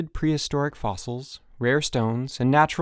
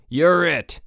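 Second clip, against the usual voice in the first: loud, higher triumphant call; shout; 6 semitones higher, 6 dB louder than usual. A narrator's reading. Frank tagging Jack Tyler, shouting. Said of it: "shouted Frank".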